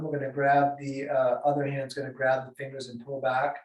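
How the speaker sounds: usual speaking voice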